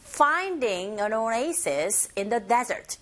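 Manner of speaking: very slow, each word laid out; slow model phrase. Shown as a demonstration. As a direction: model it slowly for copying. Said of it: "이렇게 하시면 되겠죠". (천천히 따라 하기 좋게 읽기)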